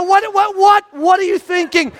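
dad scolding his sons. Retold or imitated. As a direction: chew them out.

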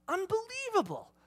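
high, awed exclamation; amazed. Real, not acted. Preacher marvelling at God's love, amazed. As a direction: marvel out loud.

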